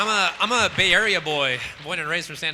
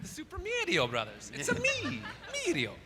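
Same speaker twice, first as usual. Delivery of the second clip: Mario voice; 10 semitones higher, 7 dB quieter than usual. Pretend. Mario catchphrase parody.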